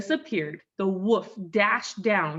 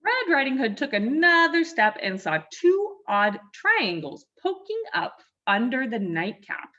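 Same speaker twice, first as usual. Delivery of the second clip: plain reading voice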